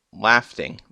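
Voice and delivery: "laughing" with a stray t, "laf-ting"; slip of the tongue. Real, not acted. Reviewer stumbling while recapping Bob's breakdown, slip of the tongue. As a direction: slip a t sound into the middle of "laughing". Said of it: "Did I just say laughing?".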